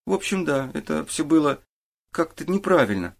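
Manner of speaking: lightly voiced boy feeling for the words; hesitant boy. A narrator's reading. Boy admits to the detective it felt wrong, hesitant.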